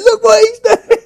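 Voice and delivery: put-on high, loud accusing girl's voice; loud tattletale cry; mock schoolgirl shout. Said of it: "shouting, saying".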